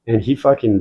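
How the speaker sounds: usual speaking voice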